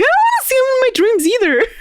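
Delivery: squeaky high, fast, hammed-up terror; mock panic. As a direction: blurt it out like a frightened kid, way up high. Caller panicking, voiced by retelling host.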